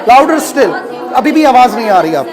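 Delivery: loud, forceful calls to speak up; shouted coaching. Real, not acted. Teacher pushing student to project, loud shouting.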